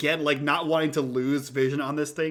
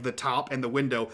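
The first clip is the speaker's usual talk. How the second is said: normal talking voice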